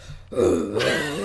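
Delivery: low gasping bull snort. Retold or imitated. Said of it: "that's what it sounds like".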